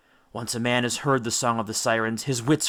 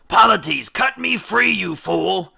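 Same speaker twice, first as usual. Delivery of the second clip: much higher, much louder scream; desperate yell; 9 semitones higher, 7 dB louder, slower than usual. Acted. Bound captain screams at Polites to free him, desperate. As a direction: scream to be cut loose. Odysseus struggling in ropes.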